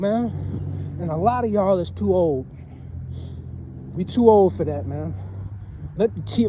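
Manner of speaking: ordinary talk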